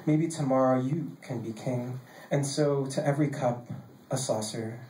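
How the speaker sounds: measured, cadenced poetry reading; poem reading. Acted. Poet reading a poem on role games to a live room, measured recital. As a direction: read it as a poem, phrase by phrase with pauses.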